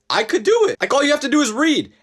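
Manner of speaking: high-pitched, emphatic rant; exasperated insistence. Acted. Ranting about following a recipe.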